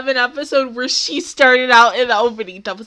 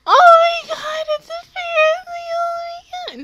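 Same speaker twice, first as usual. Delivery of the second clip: excited squeal; 4 dB louder than usual. Real, not acted. Sees Ferris wheel scene.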